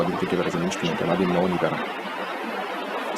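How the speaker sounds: low, expressionless drone; unvaried pitch, no expression at all; old monotone voice. Shown as a demonstration. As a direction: talk on one low note throughout. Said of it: "this is how I used to talk".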